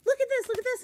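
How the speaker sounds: put-on very high, loud kid insisting; excited child. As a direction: shout it like a thrilled first-grader. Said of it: "the little girl was like".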